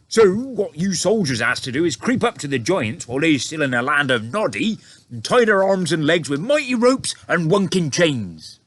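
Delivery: high, loud eager giant; BFG giant voice. A narrator's reading. BFG telling the soldiers his plan, eager high voice. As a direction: explain like a friendly giant.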